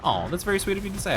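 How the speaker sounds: ordinary delivery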